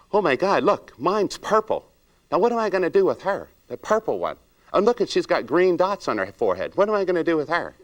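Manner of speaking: high, hammed-up gasp of judgmental shock; mock dismay. Stylist judging client's odd look.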